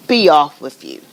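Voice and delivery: loud gruff order; troll dismissal. Acted. Troll sending the middle goat away, loud. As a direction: send the goat off.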